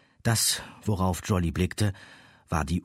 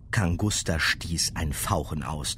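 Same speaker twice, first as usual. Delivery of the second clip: usual speaking voice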